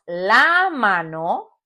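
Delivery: "la" stretched out before "mano"; teacher's drawn-out "la" in "la mano"; stress on LA. Shown as a demonstration. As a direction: slowly lean on "la", then let "mano" rise. Teacher stresses the exceptional article.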